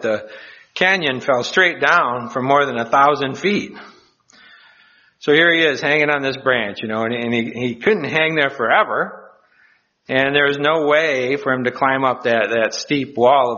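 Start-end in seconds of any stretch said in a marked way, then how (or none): none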